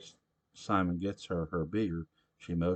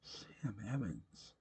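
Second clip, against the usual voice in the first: much softer, slow repeat of the name; quiet musing echo; 4 semitones higher, 10 dB quieter, slower than usual. A narrator's reading. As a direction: let the name sink in, hushed. Narrator echoes name softly.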